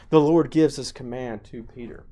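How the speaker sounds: ordinary talk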